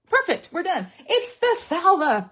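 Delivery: high, bright-eyed delight, slowing on the last words; gleeful. Real, not acted. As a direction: cheer the find. Celebrating her discovery.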